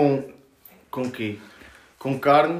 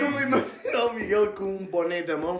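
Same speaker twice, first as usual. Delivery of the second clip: usual speaking voice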